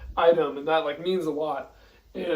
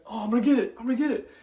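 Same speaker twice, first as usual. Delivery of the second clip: higher, eager retelling of a dad chasing a home-run ball; excited dad; 7 semitones higher, faster than usual. Retold or imitated. Vlogger imitating the dad in the crowd catching the ball, pitched up and eager. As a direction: lightly imitate the thrilled dad reaching for the ball. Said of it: "he's, he's like".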